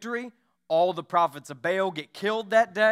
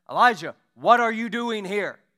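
raised, booming call into the cave; voice of God; 5 semitones higher, 7 dB louder than usual. Acted. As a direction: call out as God, searching for him. God calls to hiding Elijah.

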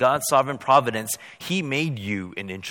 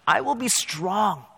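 rising preaching; 10 semitones higher, 5 dB louder than usual. Real, not acted. Pastor assuring the congregation, building excitedly. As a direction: pitch climbs higher and louder to a ringing end.

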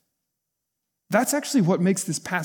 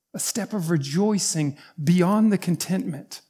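ordinary delivery

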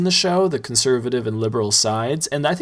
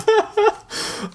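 high delighted laugh. Real, not acted. Host laughing high at cohost's dog butt story. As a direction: burst out laughing.

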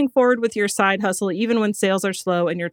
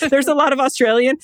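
laughing; 6 semitones higher, 4 dB louder, faster than usual. Real, not acted. Laughing at hard passage.

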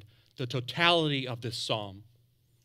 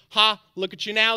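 raised, higher-pitched jeer, read aloud; mocking yell; 5 semitones higher, 9 dB louder than usual. A narrator's reading. Preacher reading out the passers-by yelling at Jesus, mocking yell. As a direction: call it out as a mock yell. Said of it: "they yelled at him".

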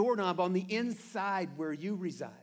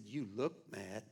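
lower, softer, slow mentor voice; mentor's blunt verdict; 12 semitones lower, 10 dB quieter than usual. Retold or imitated. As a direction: drop low and deadpan like an older mentor. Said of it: "He said".

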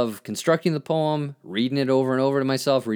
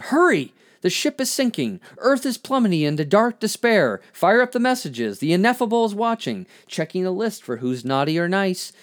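high-pitched, alarmed spoken-word outburst; urgent poem recitation; 8 semitones higher, 3 dB louder than usual. Acted. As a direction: sound the alarm in verse.

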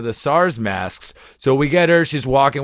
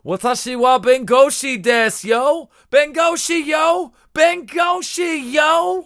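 much higher and louder, yelling bengoshi yo; shouted yo catchphrase; 3 dB louder than usual. Retold or imitated. Host yelling his new Japanese lawyer catchphrase. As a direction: shout it like a hype man. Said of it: "So I've started saying".

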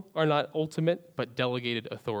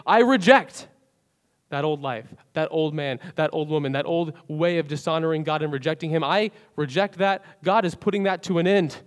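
declaring renunciation; 2 semitones higher, 5 dB louder than usual. Acted. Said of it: "we actually come and say".

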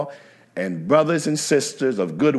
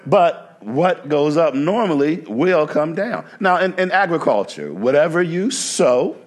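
raised, rhythmic pulpit cadence; preaching; 6 semitones higher than usual. Acted. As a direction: preach it, lifting the congregation.

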